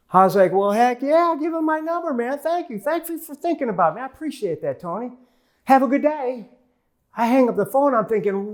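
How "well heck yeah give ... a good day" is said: excited. Retold or imitated. Thanking Tony for investor referral.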